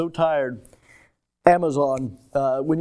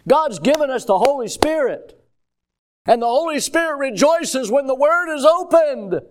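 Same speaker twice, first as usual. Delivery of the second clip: raised, higher, louder pulpit delivery; full-force preaching; 10 semitones higher, 6 dB louder than usual. Real, not acted. Preacher exulting to the church about the Holy Spirit, full-force preaching. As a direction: preach it out with ringing emphasis.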